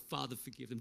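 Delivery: soft, low prayer; hushed forgiveness; quiet plea. Retold or imitated. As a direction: answer the taunts with gentle prayer. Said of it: "He was like".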